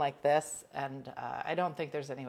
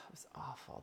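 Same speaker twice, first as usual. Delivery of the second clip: whispered aside; 3 semitones lower, 16 dB quieter than usual. Real, not acted. Recalling bed rest.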